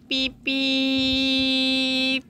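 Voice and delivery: normal talking voice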